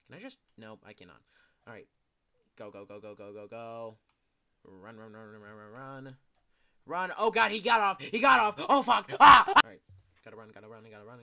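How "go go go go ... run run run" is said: ordinary talk, nothing marked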